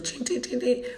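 high-pitched singsong ching noises; imitated machine chinging. Cashing out thirty dollars, imitated high machine chings. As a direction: mimic a slot machine paying out.

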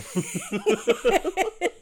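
high, loud burst of laughter; cracking up. Real, not acted. Speaker giggling at his silly term for sex, loud high laugh.

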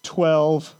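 a small, sheepish admission, kept low. Acted. Disciples sheepishly answering Jesus's question about the baskets, sheepish. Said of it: "in a very sheepish, uh, voice".

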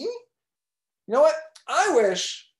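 slow, high, drawn-out build to the wish. Acted. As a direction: stretch it out dramatically. Dramatic wish.

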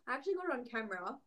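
ordinary talk

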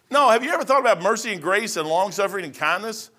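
normal talking voice

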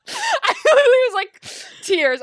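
high, loud, through laughter; laughing hard. Real, not acted. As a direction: laugh helplessly while getting the words out. Laughing while telling the story.

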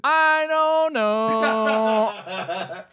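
very slow, laughing I don't know; laughing, drawn out. Real, not acted. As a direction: stretch it through a giggle. Laughs at unanswerable question.

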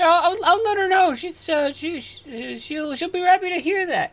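squirm and stall like a guilty borrower; flustered neighbor. Acted. Neighbor Jason stalls over the borrowed mower, high and flustered.

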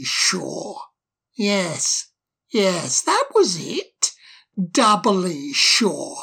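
slow, mock-polite sarcasm; sarcastic. A narrator's reading. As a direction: needle the owl with sarcasm. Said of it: "in the same sarcastic manner".

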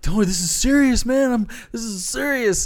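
high, loud frantic begging; panicked plea. Retold or imitated. Tripping narrator begging his laughing friend, high panicked plea.

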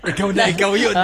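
loud, laughing outburst; laughing exclamation. Real, not acted. Host teasing the caller over a nickname, loud and laughing. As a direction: shout it through real laughter.

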